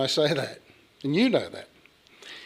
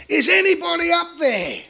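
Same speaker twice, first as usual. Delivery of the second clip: very high call up a cliff; acted yell for help; 14 semitones higher than usual. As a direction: yell it high, stretching the last word. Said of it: "he started to yell out".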